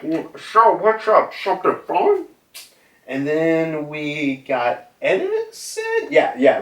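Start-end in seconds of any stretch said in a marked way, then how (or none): 0.0-2.3 higher, idle put-on voice; voicing York. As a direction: ask lazily from the background. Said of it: "York just in the background being like".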